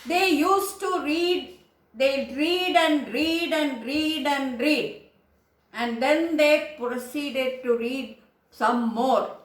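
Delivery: slow, deliberate read, each read leaned on; slow and loud. Shown as a demonstration. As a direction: spell it out slowly so every parent understands. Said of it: "We'll say it very loud and slow".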